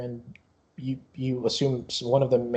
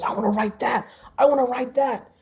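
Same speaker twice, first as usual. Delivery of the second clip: put-on higher-pitched childlike eagerness; excited kid; 9 semitones higher than usual. Yamu flying around wanting the dragon coaster, eager. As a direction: beg for the ride like a thrilled child.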